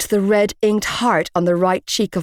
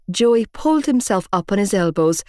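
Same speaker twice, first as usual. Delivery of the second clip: plain reading voice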